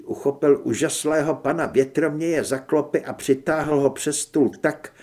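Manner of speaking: ordinary delivery, nothing marked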